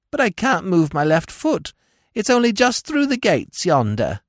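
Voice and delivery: high droning crone voice; whining old woman. A narrator's reading. Old woman pointing Smith to the wharf gates, high whine. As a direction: moan about the bad foot. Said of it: "she replied in a monotonous voice".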